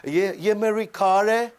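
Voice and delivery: lightly imitated drawn-out meme lines; viral meme imitation. Coach imitating a viral party clip, light meme imitation. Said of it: "इसके ऊपर सबने बहुत use किया".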